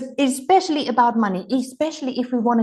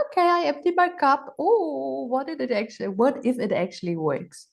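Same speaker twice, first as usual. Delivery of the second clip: open-minded wonder; 5 semitones higher than usual. Pretend. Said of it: "you just go like".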